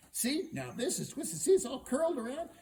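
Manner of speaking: high, loud, triumphant display; excited showing-off. Acted. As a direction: show it off loudly, pitched high. Hero shows off the horn.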